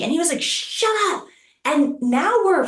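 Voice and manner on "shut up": high-pitched imitation of an irritated man; mock angry retort. Attacker silencing her on the beach, mock angry.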